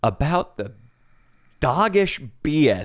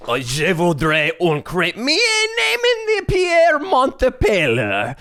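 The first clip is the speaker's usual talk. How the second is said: high, drawn-out mock Frenchman; bad French accent; 9 semitones higher, slower than usual. Shown as a demonstration. Proving to co-host he's good at it, hammy French accent. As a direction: show off an over-the-top French accent. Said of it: "Oh, I'm pretty good".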